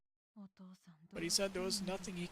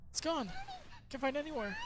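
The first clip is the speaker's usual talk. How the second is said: higher, frantic alarm; panicked cry; 9 semitones higher than usual. Acted. Finding her charm gone, a high panicked cry. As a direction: realise the charm is lost and panic.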